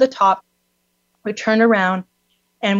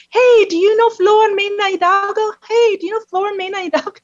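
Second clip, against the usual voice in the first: higher mock call out the car window; retold call from a car window, much higher; 12 semitones higher, 3 dB louder than usual. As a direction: hail strangers from the road, laughing.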